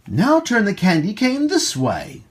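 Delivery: usual speaking voice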